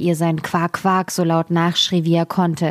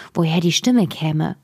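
plain reading voice

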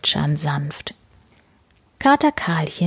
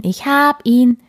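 high, loud, still thrilled; excited cub; 7 semitones higher, 6 dB louder than usual. Acted. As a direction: repeat it, hopping up and down. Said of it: "hopste aufgeregt auf und ab".

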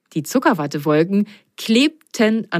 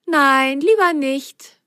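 amused; 7 semitones higher, slower than usual. A narrator's reading. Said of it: "schmunzelte Biene".

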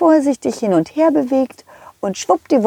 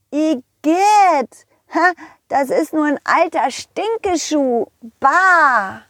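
put-on high child's voice, grossed out; drawn-out yuck in a kid's voice; disgusted little pirate; 6 semitones higher, slower than usual. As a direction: recoil from a stink as a little boy. Pirate finds a stinky shoe.

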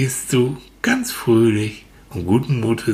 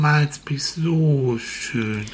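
hohe, langsame Blumenstimme; Sonnenblume spricht; 7 semitones higher, slower than usual. Acted. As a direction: freundlich flüsternde Blume. (Sonnenblume begrüßt Manfred freundlich, hoch und langsam)

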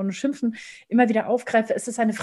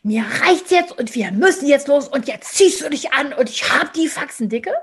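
loud, higher, exasperated rant; fed-up scolding; 4 semitones higher, 6 dB louder than usual. Shown as a demonstration. Parent in a rush scolds the child, loud and fed up. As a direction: lose patience and scold. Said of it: "Gebe ich Orientierung mit".

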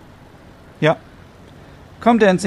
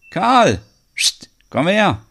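calling the dog; 7 semitones higher, slower than usual. Acted. Farmer calling his dog.

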